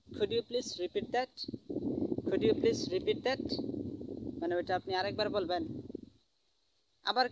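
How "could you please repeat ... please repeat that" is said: very slow, word by word English; very slow teaching demo. Shown as a demonstration. Teacher modelling a repeat request for students, very slowly. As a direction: stretch the request out for the class. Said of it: "তাহলে আপনার এটা হবে".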